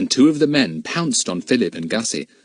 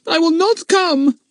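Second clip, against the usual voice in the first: put-on defiant boy; 6 dB louder than usual. A narrator's reading.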